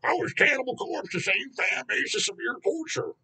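high, mocking whine of a clueless fan; mocking idiot voice. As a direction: sneer as if playing a dumb fan. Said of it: "those fucking idiots who are like".

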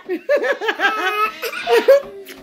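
long giggly laugh, pitched high; real playful cackling giggle. Real, not acted. Laughing with her baby.

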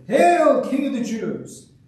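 high, loud HAIL, then slowing; mock royal salute. A narrator's reading. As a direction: hail him in mock homage, drawn out. Soldiers hail the mocked prisoner.

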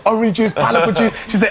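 ordinary talk

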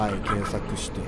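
ordinary delivery

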